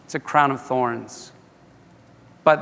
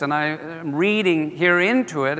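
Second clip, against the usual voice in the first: usual speaking voice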